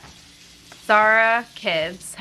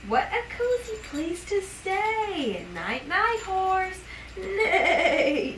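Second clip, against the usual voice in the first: bedtime story reading; 8 semitones higher, much slower than usual. Acted. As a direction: lull the baby toward sleep. Reading bedtime book to baby.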